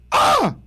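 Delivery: startled yelp. Retold or imitated. Jump-scared by his wife, a high startled yelp. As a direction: squeal like you got jump-scared. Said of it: "I responded by going".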